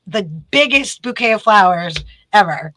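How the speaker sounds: ordinary delivery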